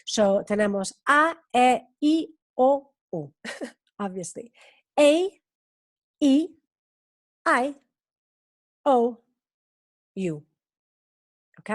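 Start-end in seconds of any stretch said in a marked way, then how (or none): 4.9-10.4 "A, E, I, O, U" con sus nombres ingleses, una a una; vocales inglesas. Shown as a demonstration. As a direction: say "A, E, I, O, U" as English letter names, with pauses. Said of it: "Lo más importante en inglés son los sonidos vocales". (vocales en inglés, bien separadas)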